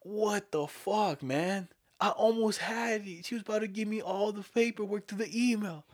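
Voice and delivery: a frustrated caller moaning at his coworker; annoyed scammer. Retold or imitated. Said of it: "I hear in the background".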